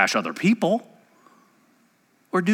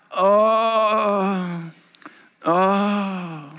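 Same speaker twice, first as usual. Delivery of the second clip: hammed-up gloomy groan; mock-suffering Pharisee groaning; mock moaning. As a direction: moan like a pitiful faster. Mimics gloomy fasting Pharisee.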